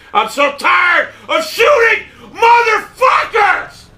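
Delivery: slow, high, strained yell; furious crying shout. Real, not acted. Gamer raging at enemies who run away, slow strained shout. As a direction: scream it out, nearly breaking.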